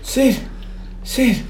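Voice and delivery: mock scream. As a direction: call out in a high, half-hearted mock scream. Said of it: "Sid screams for Dewey".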